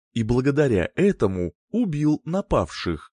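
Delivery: usual speaking voice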